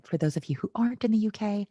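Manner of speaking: ordinary talk, nothing marked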